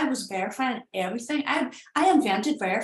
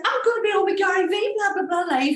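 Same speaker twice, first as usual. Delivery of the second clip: much higher and louder, thrilled; running up to tell her husband, very high and giddy; giddy excitement; 10 semitones higher, 5 dB louder than usual. Retold or imitated. Excited shouting to her husband. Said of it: "So I'm running up saying".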